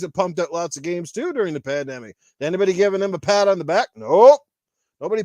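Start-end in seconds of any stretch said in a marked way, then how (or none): 4.0-4.4 much louder single-word bark; loud blunt nope. Real, not acted. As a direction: shout the answer down flat. Ranting, answers own question.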